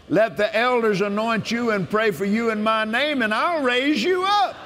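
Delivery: full-force preacher's proclamation; preaching at full force. Acted. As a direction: lift the promise up and call it out. Proclaims scripture in God's voice.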